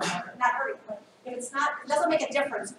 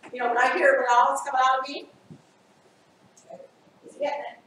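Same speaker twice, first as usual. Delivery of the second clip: ordinary talk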